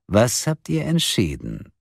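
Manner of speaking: slow, swooping, drawn-out question; metallic voice. A narrator's reading. Kidnapper asking for their choice, metallic taunting. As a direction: draw out the question teasingly. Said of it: "die metallische Stimme".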